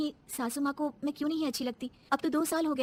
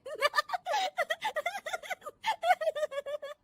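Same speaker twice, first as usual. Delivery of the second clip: long high-pitched giggling; high giggle. Acted. Daughter-in-law giggles at TV serial, high giggle.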